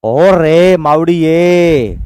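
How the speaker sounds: very slow, drawn-out "ओ रे मावड़ी ये"; hammed-up cry of distress; mock lament. Shown as a demonstration. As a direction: wail it like a child calling for mother. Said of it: "ऐसे बोलते हैं ना कई बार".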